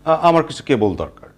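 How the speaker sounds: ordinary talk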